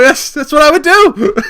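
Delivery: high, loud, laughing voice; real laughter. Real, not acted. Podcaster cracking up at his own interpreter joke, laughing loudly. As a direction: squeal it out through laughter.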